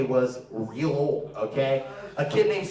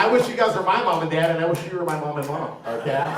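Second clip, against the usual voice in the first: ordinary delivery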